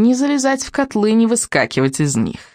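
lightly voiced witch, lifting then dropping; a hint of frosty crackle; crackling witch. A narrator's reading. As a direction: put a little crackle into the witch. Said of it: "трескучим, как мороз, голосом".

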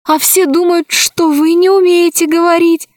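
high, surprised delight; pleased and amazed. Acted. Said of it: "обрадовалась Алиса".

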